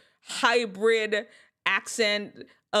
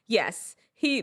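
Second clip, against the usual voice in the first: ordinary delivery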